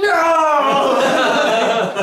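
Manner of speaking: high, loud laugh breaking through; can't hold back the laugh; real burst of laughter. Real, not acted. Laughing at his own Office retelling, loud burst.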